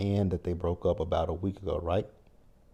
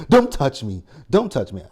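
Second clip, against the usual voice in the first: loud, pushing away anyone who reaches out; louder and higher, don't touch me; mock outburst from an upset fiancée; angry snap; 6 semitones higher, 10 dB louder than usual. Upset woman pushes people away.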